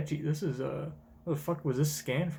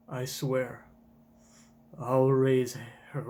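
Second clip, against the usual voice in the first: slow solemn promise; understated slow oath; slow vow; 3 semitones lower, slower than usual. Acted. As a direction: vow it slowly. Kuma's vow.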